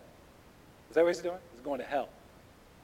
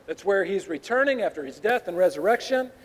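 ordinary talk, nothing marked

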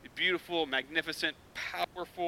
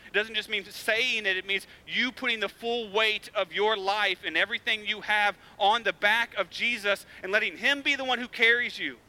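ordinary talk, nothing marked

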